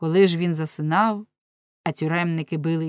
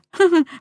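a sudden high, loud laugh; a sharp mocking laugh; loud burst of laughter; 11 semitones higher, 9 dB louder than usual. Laugh between quotes. (гучний глузливий сміх)

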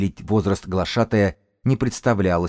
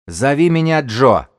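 slow, loud, high call; 7 semitones higher, 7 dB louder than usual. Acted. Gang's elder gives his name, slow loud shout. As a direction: announce it across the distance.

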